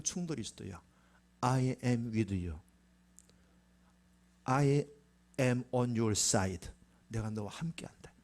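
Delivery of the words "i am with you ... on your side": very slow, low-pitched promise; solemn assurance. Acted. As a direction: give each word room, low and steady. God's promise, slowly declared. (낮고 천천히 엄숙하게)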